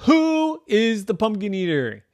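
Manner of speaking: high, loud question; exasperated demand. Acted. Rose questioning the whole family, exasperated. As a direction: demand an answer at once.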